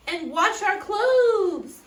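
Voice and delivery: loud, high, drawn-out demand; demanding dwarf. A narrator's reading. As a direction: stretch out the order. Clumsy dwarf demands chores.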